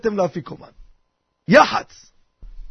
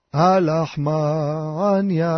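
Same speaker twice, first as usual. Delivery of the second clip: low, drawn-out recitation of the Haggadah line; slow solemn recitation; 6 semitones lower, much slower than usual. Shown as a demonstration. Leading the seder table into the Haggadah, slow low intoning.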